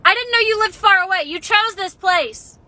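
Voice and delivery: high-pitched exasperated comeback; exasperated retort. Retold or imitated. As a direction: protest in disbelief, stretching the last words. Exasperated retort to date's gas complaint.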